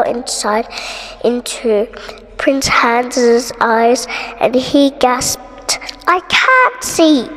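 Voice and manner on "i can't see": high, loud and slow cry; cried out. A narrator's reading. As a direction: cry it out in wonder. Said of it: "he cried".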